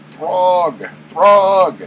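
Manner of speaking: loud, high call to a monster; mock summoning cry. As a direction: cry out like a villain summoning a beast. Dying queen calls her monster.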